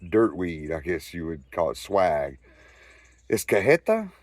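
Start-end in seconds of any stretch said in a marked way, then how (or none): 3.3-4.1 high, doubting "It's cajeta?" with a lifted "cajeta"; "cajeta" thrown back up in pitch like he can't believe it; a cop's mock-suspicious repeat of "cajeta"; skeptical question. Cop questions the weed.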